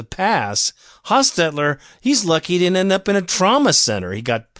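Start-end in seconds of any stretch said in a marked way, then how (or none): none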